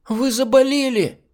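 put-on loud, very high, slow girlish cry; alarmed squeal. A narrator's reading. Girl telling the hostess she is ill, squealing.

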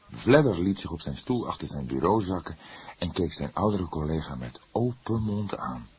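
usual speaking voice

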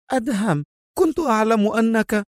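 high woman's cry of relief; excited exclamation. A narrator's reading. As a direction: call out to him, high and eager. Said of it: "وهي تهتف".

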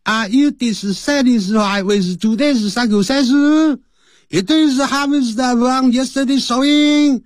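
high, slow monotone drone, syllable by syllable; wrong intonation demo. Shown as a demonstration. Teacher showing learners how not to read it, bad intonation demo. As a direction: chant it wrongly on one high level pitch. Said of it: "不要念成呢".

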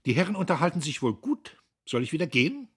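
put-on Guste, feverish young woman. A narrator's reading. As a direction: rattle it off as Guste, nervy and edgy. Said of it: "fragte Guste". (Guste, höher und schnell, fiebrig plappernd)

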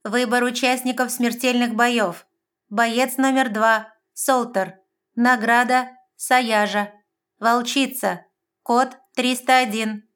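high, loud, slow machine announcement; electronic announcer. A narrator's reading. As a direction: announce it like an arena computer. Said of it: "громкая информация".

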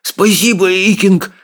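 high, huffing little voice; high-pitched, winded gratitude; puffing thanks. A narrator's reading. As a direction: puff out your thanks. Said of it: "пропыхтел Рыбьеног".

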